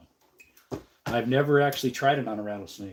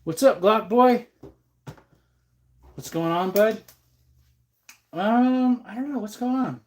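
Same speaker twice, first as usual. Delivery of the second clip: high, loud cheerful hello; excited greeting; 9 semitones higher, 5 dB louder than usual. Real, not acted. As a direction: greet a buddy with a big cheer. Greeting a viewer loudly.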